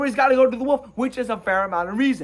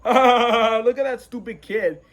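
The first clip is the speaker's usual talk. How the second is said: jeering laugh, drawn-out words; hammed-up derisive laughter; mocking laughter; 2 semitones higher, slower than usual. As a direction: laugh at him like a mocking crowd. Animals mock the would-be wolf.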